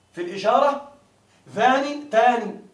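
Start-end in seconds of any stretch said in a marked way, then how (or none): 1.5-2.6 ذان تان spelled out slowly, each word apart; slow citation. Shown as a demonstration. Teacher citing the forms for students, slow and clear. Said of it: "teacher citing the forms".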